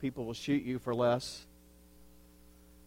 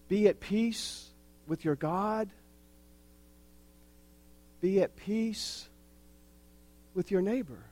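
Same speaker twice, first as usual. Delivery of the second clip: gentle blessing; 5 semitones higher than usual. Acted. Soft blessing of peace.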